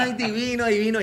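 high mock gushing; imitating a delighted woman cooing; imitated swooning women. Voices the women watching the proposal.

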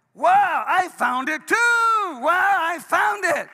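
high, loud overjoyed shouting; shouted joy. Retold or imitated. Newly saved man reading the sign, shouting joyfully. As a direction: shout it like you just discovered treasure. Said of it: "I shouted it out from the mountain".

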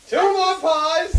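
excited shout. Acted. Excited announcement.

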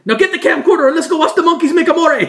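high, loud goofy Jersey guy; rally the boys like an excited dim tough guy; mock basement guy grabbing the camcorder. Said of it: "it's like".

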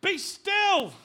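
high, loud, ringing "Be still"; shouted command. Acted. Preacher crying the verse to the congregation, loud. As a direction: cry "Be still" out as a final command.

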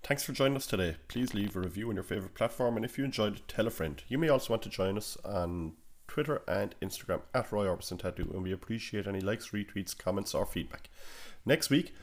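soft, scripted sign-off read; podcast outro read. Acted. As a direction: read the closing plugs quietly and evenly.

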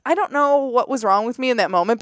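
ordinary talk

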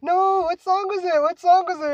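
high excited voice; imitating the girl. Girl on the stairs asking what song, high voiced. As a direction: quote her eager question up high. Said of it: "And she just like".

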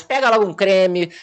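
ordinary talk

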